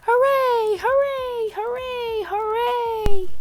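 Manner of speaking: high, long joyful hoorays; cheering. A narrator's reading. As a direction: throw every cheer up high. Trap cheers over found map.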